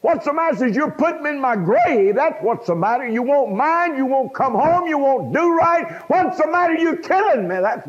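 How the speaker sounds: high, loud anguished screaming; a mother screaming through tears at her teenage boy. Retold or imitated. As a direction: shriek it at your son like a heartbroken mother. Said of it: "And she screamed".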